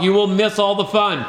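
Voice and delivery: ordinary delivery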